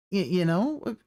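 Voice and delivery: ordinary delivery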